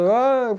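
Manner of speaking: high, drawn-out "aah" yell; imitated yell. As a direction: re-enact crying out from the pitch. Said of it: "Και φώναζα από κάτω". (αναπαράσταση κραυγής)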